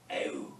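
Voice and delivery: Ew with both vowels run together; blended Ew. Shown as a demonstration. Teacher showing students the diphthong, blended Ew. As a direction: say Ew as one glide from "eh" into "oo". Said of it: "Try to get them together".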